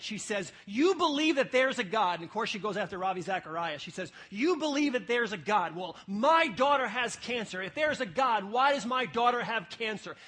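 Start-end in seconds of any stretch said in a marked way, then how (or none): 0.6-2.2 loud, raised, worked-up accusation; upset caller. Retold or imitated. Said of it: "She called in really upset". 4.3-10.2 loud, high, distraught challenge; worked-up mother. Retold or imitated. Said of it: "She was worked up".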